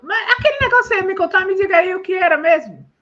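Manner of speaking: high-pitched, acted nagging curiosity; burning curiosity. Person asking about the promised news, playful burning curiosity. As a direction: plead to be told, high and amused. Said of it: "Tô aqui me moendo de curiosidade".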